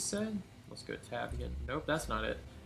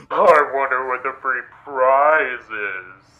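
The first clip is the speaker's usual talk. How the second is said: mock sinister echo; 13 dB louder, slower than usual. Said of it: "it's times like those I don't trust you".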